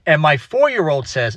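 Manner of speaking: ordinary talk, nothing marked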